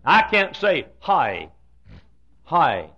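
Hi drawn into two syllables; southern two-part Hi. Shown as a demonstration. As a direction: let Hi dip and glide like a southern drawl. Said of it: "I can't frame my mouth".

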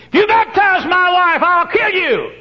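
high, loud put-on voice of a threatening man; threatening Texan. Texan husband threatening the preacher, loud put-on voice. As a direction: bark the threat like an angry husband.

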